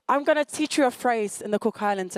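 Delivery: ordinary talk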